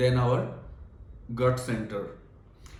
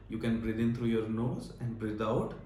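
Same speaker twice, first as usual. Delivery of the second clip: soft, lower breathing-guide voice; guided meditation; 3 semitones lower, 9 dB quieter than usual. Real, not acted. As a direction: lead them gently through deep breaths, hushed. Leads a guided breathing exercise. (soft guided breathing with long pauses)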